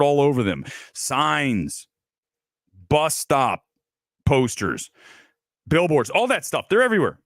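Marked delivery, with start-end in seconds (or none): none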